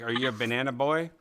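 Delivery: normal talking voice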